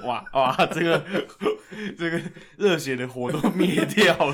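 loud laughing reply; laughing loudly. Real, not acted. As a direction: laugh through the words. Laughing reaction to the story.

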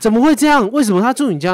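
high, loud incredulous questions; imitating the friend's outraged disbelief; retold shock. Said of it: "然后我朋友很惊讶". (模仿朋友难以置信地高声质问)

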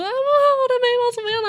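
high, wailing cry to her parents; retold panicked cry. Running out to her parents about her eyebrows, a high panicked wail. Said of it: "我反正我就大叫".

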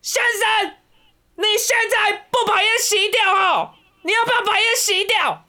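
hammed-up high-pitched scolding, slow and drawn out; crazy woman yelling. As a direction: squeal it way up like the unhinged lady scolding. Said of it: "整条延吉街都听得到".

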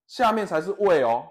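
mildly scolding coach voice; a touch of a strict trainer scolding; retold strict coach lecturing a trainee; stern coach. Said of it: "这教练很凶欸".

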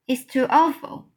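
crying out. A narrator's reading. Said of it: "Sophie cried".

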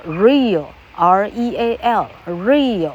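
real drawn out slowly and spelled letter by letter; real as one slow syllable; slow spelled-out English. Shown as a demonstration. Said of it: "例如说".

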